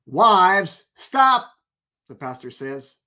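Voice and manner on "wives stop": louder, higher start cut off sharply; loud read then stop. Retold or imitated. Husband reading aloud until the pastor stops him, loud. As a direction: read proudly, get halted.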